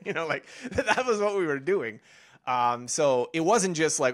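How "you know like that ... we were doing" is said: talking through a laugh; higher, laughing as you speak; amused laughing. Real, not acted. Speaker recalls practicing basic Spanish with his roommate, amused laughing.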